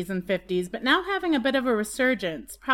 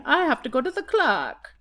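playful English-accented "clerk"; 4 semitones higher than usual. Shown as a demonstration. Co-host trying out the word, in a put-on English accent. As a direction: try it in a mock English accent.

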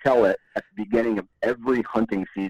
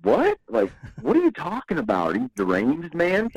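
high incredulous squawk on what, then mock-bewildered; mock-incredulous gas station stranger; baffled disbelief; 6 semitones higher than usual. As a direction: play the stunned local who can't believe it. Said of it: "he just kinda like".

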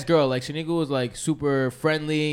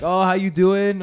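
mild cheery neighbor greeting, higher and louder, slowed; 5 semitones higher, 6 dB louder, slower than usual. Retold or imitated. Retelling the neighbor's greeting over the barbecue, mild cheery impression. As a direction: lightly mimic a chirpy neighbor. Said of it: "and she's like".

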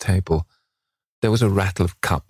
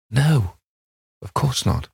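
higher-pitched, eager denial; cried out; 5 semitones higher, slower than usual. A narrator's reading. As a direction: cry it lightly, pitch lifted. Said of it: "cried Dorian".

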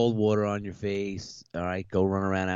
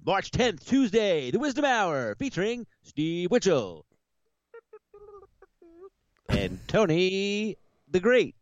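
radio host intro; 11 semitones higher, slower than usual. Pretend. Cohost opening the podcast, booming radio announcer voice. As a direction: announce the show like a big-time radio host. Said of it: "I should did the radio voice".

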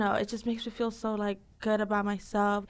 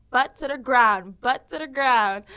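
loud, slow, higher-pitched coaching call; shouted class command; 4 semitones higher, 8 dB louder, slower than usual. Acted. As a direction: call it out slowly over the class. Calling orders to twerking class.

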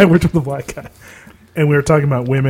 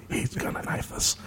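whispered joking fear; mock scared whisper; 18 dB quieter than usual. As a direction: breathe it out as if he might hear. Mock fear, walking away.

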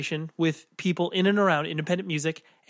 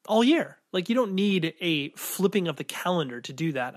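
usual speaking voice

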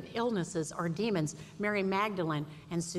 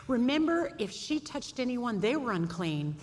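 ordinary talk, nothing marked

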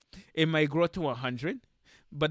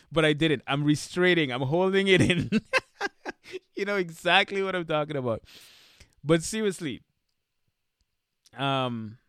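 usual speaking voice

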